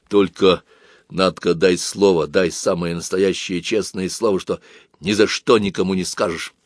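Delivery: ordinary delivery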